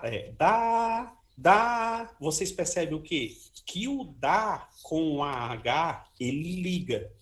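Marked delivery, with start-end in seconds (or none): 0.3-2.1 high, long-held "da", repeated; syllable demonstration. Shown as a demonstration. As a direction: sustain each "da" like a sung note. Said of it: "Agora fala vocês". ("da" agudo e prolongado)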